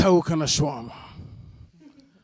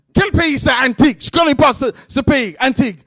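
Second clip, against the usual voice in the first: high, louder mock indignation; imitated angry yelling; 6 semitones higher, 5 dB louder than usual. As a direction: scoff loudly like an outraged man. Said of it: "This guy starts yelling".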